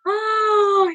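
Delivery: high joyful squeal of delight; happy squeal. Real, not acted. Host squealing at a viewer's pregnancy news, delighted.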